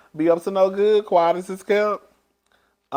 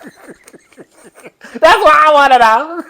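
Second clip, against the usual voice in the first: much higher and louder, slow, through laughter; laughing; 12 semitones higher, 10 dB louder, much slower than usual. Real, not acted. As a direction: squeal it out while cracking up. Laughing at gossip.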